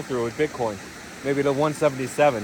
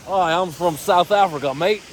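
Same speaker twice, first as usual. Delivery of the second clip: badly faked South African accent; 4 semitones higher than usual. Streamer guessing where a yelling man came from, in a bad South African accent. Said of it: "That's a bad accent".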